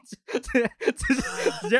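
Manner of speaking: words lost in a high laugh; giggling through the words. Real, not acted. Laughing at his own blunt reply to the soldier.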